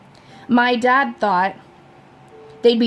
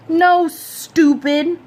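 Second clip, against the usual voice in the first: slow, drawn-out "stupid"; teasing scold; 8 semitones higher, 4 dB louder, much slower than usual. A narrator's reading. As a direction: drag it out mockingly. Friend teases.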